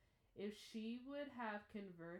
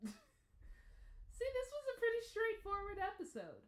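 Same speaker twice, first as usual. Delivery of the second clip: higher and louder through a laugh; laughing exclaim; 7 dB louder than usual. Real, not acted. Reviewer laughing at the episode's simplicity, high and loud. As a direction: burst out giggling at how simple it was.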